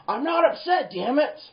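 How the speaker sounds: high, loud angry protest; worked-up yell that betrays being upset; exasperated denial. Acted. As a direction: snap under repeated accusation. Acts escalating denial to manager.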